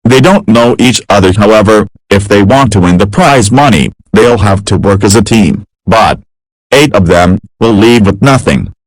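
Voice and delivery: loud, punchy quiz-show intro read; dramatic TV host introduction; game show announcer. Acted. Game show host opening voiceover.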